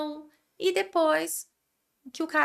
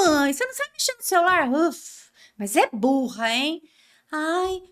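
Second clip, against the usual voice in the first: bratty teen mocking mom. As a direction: roll your eyes and sneer like a teen. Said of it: "o fofinho vira os olhinhos pra você e fala".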